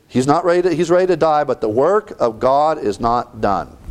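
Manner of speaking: ordinary delivery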